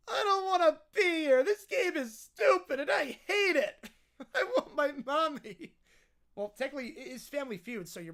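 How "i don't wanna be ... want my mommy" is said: put-on tearful bratty child voice, dragged out; mock-crying little kid tantrum; whiny kid. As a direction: whine like a toddler wanting out. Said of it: "peak whiny kid territory".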